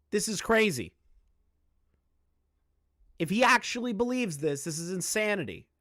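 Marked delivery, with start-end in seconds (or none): none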